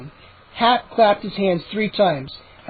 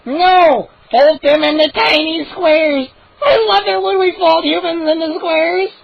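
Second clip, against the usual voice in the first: much higher, loud, gleeful shouts; excited gnome shouts; 9 semitones higher, 5 dB louder than usual. Acted. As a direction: yell out cruel games with glee.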